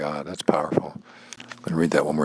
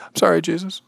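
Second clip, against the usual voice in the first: higher mock apology, lifting at the end; apologetic; 13 semitones higher, 4 dB louder than usual. Speaker imagining apologizing to Jesus, apologetic. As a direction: correct yourself with a sheepish sorry. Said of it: "wouldn't say".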